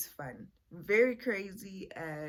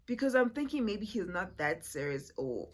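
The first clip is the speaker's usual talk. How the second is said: usual speaking voice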